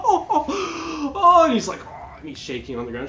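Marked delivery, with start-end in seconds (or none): 0.0-1.4 high loud delighted cackle; gleeful cackle. Acted. Villainess sister cackles at the orc's death, high and loud. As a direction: cackle at the kill.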